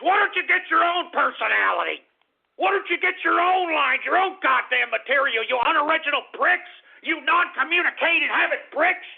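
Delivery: high, heated tirade; outraged scolding rant; angry berating. Real, not acted. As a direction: tear into the copycats indignantly. Berating prank callers.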